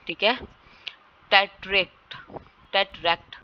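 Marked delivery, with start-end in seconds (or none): 1.3-2.2 टेट्रेक्ट with the stress on its final ट्रेक्ट; stress on second syllable. Shown as a demonstration. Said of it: "पे stress होगा". 2.7-3.4 टेट्रैक्ट with weight on the final ट्रैक्ट; stress on second syllable. Shown as a demonstration. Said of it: "पे stress होगा".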